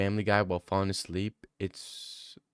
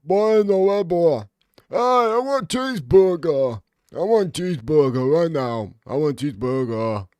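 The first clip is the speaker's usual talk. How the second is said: Duke Dennis impression; 6 semitones higher, 5 dB louder than usual. Streamer mocking Duke Dennis in a high, loud put-on voice. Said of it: "impression of Duke Dennis".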